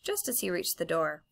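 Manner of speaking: ordinary delivery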